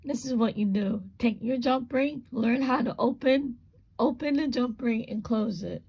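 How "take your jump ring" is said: normal talking voice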